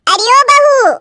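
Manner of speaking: loud, high summoning shout; calling out. Acted. Mother-in-law summoning the bride to the door, shouting. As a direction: holler for someone out of sight.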